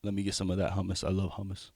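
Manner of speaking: soft, offhand going-along; quiet mock agreement. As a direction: play along under his breath. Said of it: "I'll just be like".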